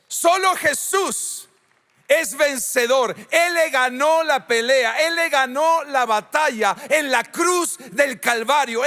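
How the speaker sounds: high, forceful pulpit delivery; impassioned preaching. Real, not acted. (predicación apasionada)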